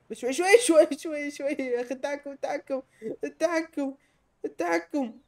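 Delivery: high-pitched, frantic repetition; high, exasperated alarm; panicked pleading. Real, not acted. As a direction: plead with controls that won't obey. Complains of controls while slipping.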